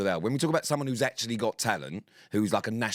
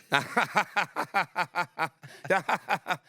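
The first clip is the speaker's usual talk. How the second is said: high, forced staccato laugh; drawn-out mock laughter, one "ha" after another; weird fake laugh; 9 semitones higher than usual. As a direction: imitate someone's awkward fake cackle. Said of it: "doing that weird sort of fake laugh".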